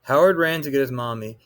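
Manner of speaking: ordinary delivery, nothing marked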